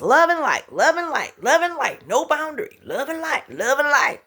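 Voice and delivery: mock her own old all-love softness; singsong love and light. Mocking her own soft approach.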